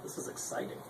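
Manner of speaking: dry deadpan whisper aside; hushed ironic aside; sarcastic whisper. Real, not acted. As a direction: whisper it, mocking the dull moment.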